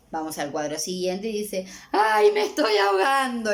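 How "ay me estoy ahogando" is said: louder, higher mock panic; child hamming up drowning, mock panic; dramatic child. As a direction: overplay drowning while having hair rinsed. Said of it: "le dice el niño".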